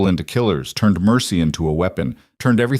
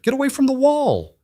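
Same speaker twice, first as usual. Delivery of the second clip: shouted order. Acted. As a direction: bark the retreat across the courtyard, much higher. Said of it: "Eric shouted".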